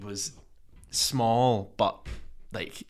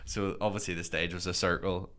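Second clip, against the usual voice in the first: usual speaking voice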